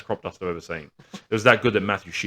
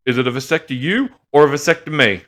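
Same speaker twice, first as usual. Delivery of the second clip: mock dad quip; 8 semitones higher, 11 dB louder than usual. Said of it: "he goes".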